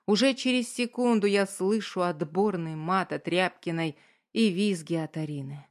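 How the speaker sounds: usual speaking voice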